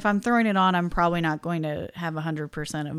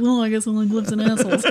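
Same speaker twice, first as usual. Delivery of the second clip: Dan Aykroyd quote; 2 semitones higher, 4 dB louder, faster than usual. Retold or imitated. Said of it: "he goes".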